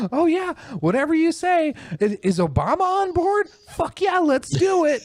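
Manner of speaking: very high, dopey eager voice; mock sheep. Mocking a virtue-signaled writer, very high eager dopey voice. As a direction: squeal like a mindless yes-man. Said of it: "to where you just go".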